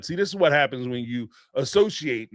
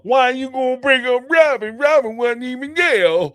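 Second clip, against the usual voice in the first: higher, louder mock protest; 9 semitones higher, 6 dB louder than usual. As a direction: whine it back as if wronged. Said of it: "he go".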